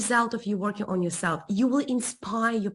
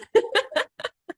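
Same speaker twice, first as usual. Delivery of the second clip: high laugh. Real, not acted. Laughing at end of story.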